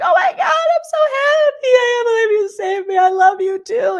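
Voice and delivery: overjoyed squeal of thanks to her saviour; gushing thanks. Retold or imitated. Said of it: "She's like".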